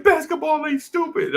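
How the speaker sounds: high, hurt protest; pouting kid. Retold or imitated. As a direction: insist like an upset little boy. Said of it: "And I was like".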